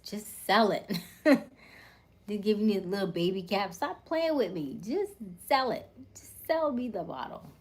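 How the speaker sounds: loud, high mock outrage; playful demand. Real, not acted. Talking to the restaurant, demanding they bottle it. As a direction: insist like a fed-up customer.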